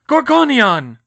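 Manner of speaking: high, loud shield cry; spell shout. Acted. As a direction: throw up the shield.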